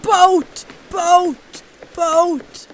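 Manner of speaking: loud one-word exclamations; excited. Real, not acted. Player keeps spotting a boat, excited. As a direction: call it out with real excitement each time. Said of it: "I'm sorry, I'm getting excited".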